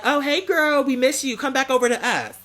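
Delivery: sing-song invitation back; playfully high calling a friend back over; sweet high friendly call. Retold or imitated.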